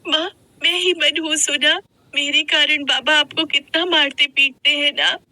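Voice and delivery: put-on high-pitched, self-blaming child; sorrowful little girl. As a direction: speak sadly in a high child's voice. Child blames herself to mother.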